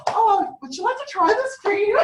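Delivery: bright high-pitched mock offer; chirpy sales offer. Acts out offering cream to strangers.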